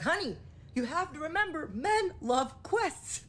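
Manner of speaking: high, drawn-out lesson to a friend; emphatic advice. Retold or imitated. Telling a friend about men, emphatic advice. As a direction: pitch it up and stretch the key words slowly.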